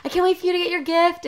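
excited. Retold or imitated. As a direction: gush with anticipation. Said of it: "I'm like".

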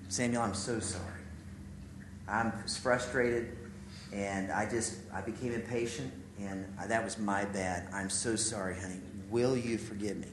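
soft, low, retold apology; tender apology. Dad saying sorry to his young son, tender and hushed. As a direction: ask forgiveness quietly and gently.

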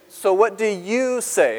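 YOU leaned on and drawn out, slowly; emphatic, stretched "you"; stress on YOU. Shown as a demonstration. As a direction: hit the word "you" as a slow challenge. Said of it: "you" should be italicized there".

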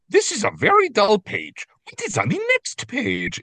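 a fidgety monster bored with the copyright page; Grover voice. Retold or imitated. Co-host quoting Grover on the dull page, high and whiny.

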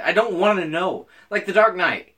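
ordinary talk, nothing marked

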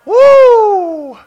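loud, high "woo"; exultant whoop. Real, not acted. Preacher infused with strength, exultant whoop. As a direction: let out a high celebratory whoop.

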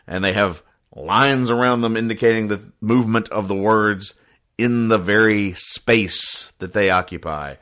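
ordinary delivery

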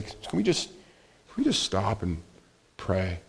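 soft, halting request to pray; wife's gentle plea. Retold or imitated. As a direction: quietly interrupt an argument, slowing down. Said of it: "often Sarah, will say".

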